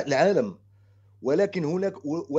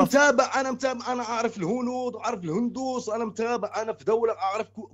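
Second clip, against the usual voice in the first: pitched up and pressing; insistent, raised pitch; 6 semitones higher than usual. Real, not acted. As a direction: insist hard, voice high. Caller insists heatedly, high.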